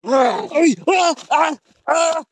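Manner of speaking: loud, high repeated screams; startled high-pitched yells; screams under attack. Real, not acted. Attacked during the creature hunt.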